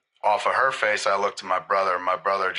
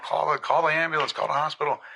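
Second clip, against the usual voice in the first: mock panic, pitched up; freaked-out mom; 7 semitones higher than usual. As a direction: imitate a mother's alarmed repeated question. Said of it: "Mom freaked out".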